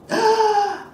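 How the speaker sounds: high, loud gasp; sudden dramatic intake of breath; sharp gasp. Acted. Acting the cut opening the fish.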